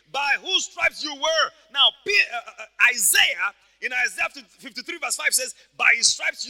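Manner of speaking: loud, high scripture declamation; full-force preaching. Acted. Preacher quoting Second Peter to congregation, full-force preaching. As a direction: proclaim the verse slowly at full volume.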